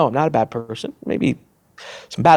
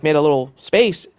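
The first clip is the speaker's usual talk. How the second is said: normal talking voice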